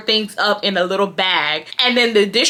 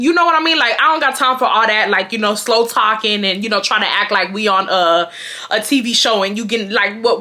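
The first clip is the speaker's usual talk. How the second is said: usual speaking voice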